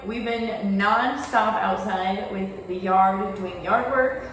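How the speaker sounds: usual speaking voice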